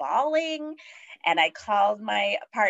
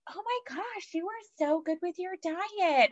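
much higher, airy and gushing; gushing nurses; 13 semitones higher, 3 dB quieter than usual. Retold or imitated. Nurses praising the new mother's diet, gushing much higher. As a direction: coo praise like a delighted nurse.

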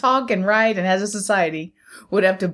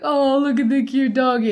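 cooing at a dog; 5 semitones higher than usual. Pretend. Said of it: "they'll be like".

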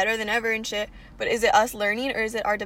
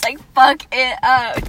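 slow, loud fuck it up; Valley Girl slang; 11 dB louder, slower than usual. Shown as a demonstration. Friend showing how they really talk, slow and loud. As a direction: drag it out loud and brash. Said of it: "We're like".

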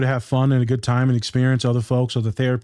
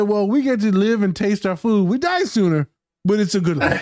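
sassy retort; 8 semitones higher than usual. Retold or imitated. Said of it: "I said".

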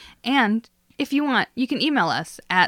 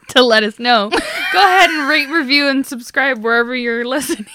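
louder, laughing while reading the outro; giggly promo read; laughing through it; 3 semitones higher, 8 dB louder than usual. Real, not acted.

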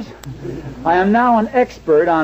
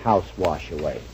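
much lower, much softer scoffing repeat; mocking echo; 12 semitones lower, 9 dB quieter than usual. Husband scoffing at wife's worry, mocking echo. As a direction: mutter her words back to belittle them.

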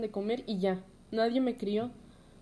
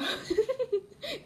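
high bitter laugh; 14 semitones higher than usual. Real, not acted. Bitter laugh after divorce remark.

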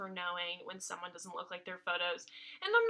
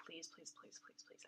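softer, breathless pleading please; whispered begging; 4 semitones lower, 22 dB quieter than usual. Retold or imitated. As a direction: beg them in a hushed voice. Begging strangers for shelter.

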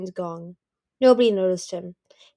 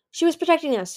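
quick; 8 semitones higher, 5 dB louder than usual. A narrator's reading. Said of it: "Tsunami said quickly".

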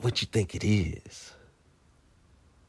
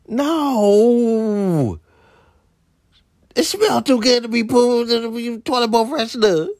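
high, indignant insistence; mock protest; 8 semitones higher than usual. Arguing with the niece, high indignant protest. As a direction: insist it's too good.

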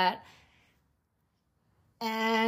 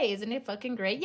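ordinary talk

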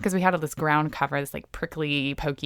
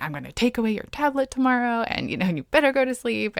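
higher-pitched, mildly harsh retold warning; harsh parent; 8 semitones higher than usual. Mom warning her child at bedtime, mildly harsh. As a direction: lay down the law, half in earnest. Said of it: "very harsh and just like".

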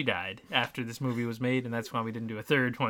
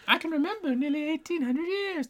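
very high, thin falsetto; Muppet ghost. Acted. Co-host recalling the ghost's line, in high falsetto. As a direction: recite in a put-on high spirit voice. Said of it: "She said something similar, like, to that".

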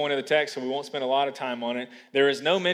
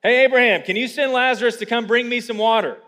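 loud, higher mock summons; entitled request; 7 semitones higher, 6 dB louder than usual. Preacher mocks rich man wanting Lazarus to serve, entitled request. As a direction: order it like summoning a servant. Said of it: "is still asking for Lazarus to serve him".

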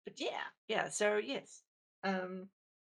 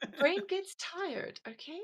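posh voice; 10 semitones higher, 3 dB louder than usual. Real, not acted. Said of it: "Val's posh voice came out then".

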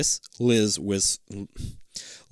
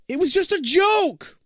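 loud, high, slow call; yelling; 5 dB louder than usual. Acted. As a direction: plead across the street. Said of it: "he yells".

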